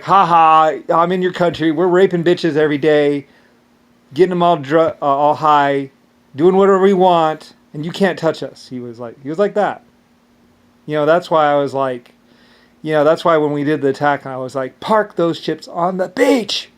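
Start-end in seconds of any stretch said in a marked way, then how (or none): 0.0-8.7 gloating taunt. Retold or imitated. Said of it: "Mister Brown was like". 14.8-16.7 angry order. Retold or imitated. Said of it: "I was pissed".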